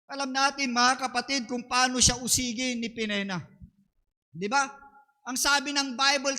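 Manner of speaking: ordinary talk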